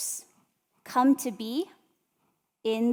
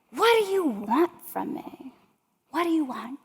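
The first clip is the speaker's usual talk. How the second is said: higher, louder frustrated yell at her daughter; imitating mom yelling in exasperation; retold scream; 4 semitones higher, 3 dB louder than usual. Mom yelling at her daughter during a hotel fight, frustrated shout. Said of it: "and she screams at me".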